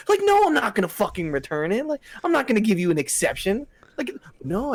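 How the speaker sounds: high-pitched, exasperated no; indignant refusal. Acted. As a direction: refuse as if the request is absurd. Indignant reply to imagined customer.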